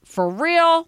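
high, rising question of disbelief; mock incredulous. Immigration case worker hearing of the engagement, incredulous. Acts out the immigration officer's reaction.

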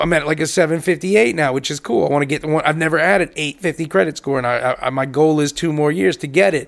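normal talking voice

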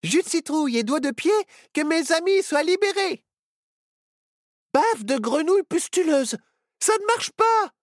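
high, loud spell chant; casting a spell. Acted. Apprentice witch casts a spell to free her friends, hurried high chant. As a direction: chant the incantation in a hurry. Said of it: "Myrtille tenta de jeter un sort".

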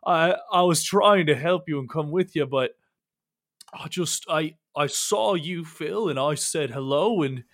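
loud, stammering apology with bits of laughter; flustered clumsy oaf. Acted. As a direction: play a panicky oaf begging forgiveness. Game master plays Horace apologizing.

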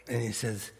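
ordinary talk, nothing marked

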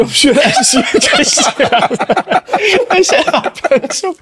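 high, slow, breaking into laughs; laughing. Real, not acted. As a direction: talk through giggles. Laughing while talking.

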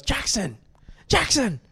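high scolding call, rising on the repeat; mum calling out. Retold or imitated. Mum calling out teller's name sharply.

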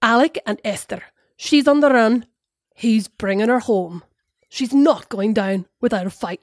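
a hint of a Scottish accent, drifting Northern Irish; half-hearted Scottish accent sliding into Northern Irish; attempted Scottish accent. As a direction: read the blurb in a light Scottish accent. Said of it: "give us a bit of a Scottish accent".

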